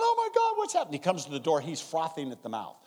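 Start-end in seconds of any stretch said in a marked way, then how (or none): 0.0-1.0 much higher, louder alarmed wife voice; mock the wife's alarm; panicked wife.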